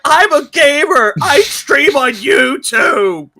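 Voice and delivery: high, slow, tearful imitation; Good Doctor meme voice. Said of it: "And he's just like".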